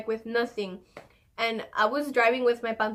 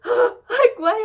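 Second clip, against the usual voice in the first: gasping, high-pitched disbelief; re-enacted startled outburst; shocked gasp; 12 semitones higher, 9 dB louder than usual. Shocked reaction to dog jumping.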